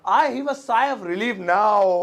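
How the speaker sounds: "I have a sigh of relief now" drawn out word by word; very slow model. Shown as a demonstration. Teacher repeats the example very slowly. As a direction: stretch each word for learners. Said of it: "जैसे".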